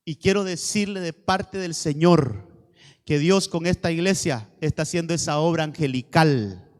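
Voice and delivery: loud, emphatic declaration to the congregation; full-force preaching. Real, not acted. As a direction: preach it out with slow, weighty stresses.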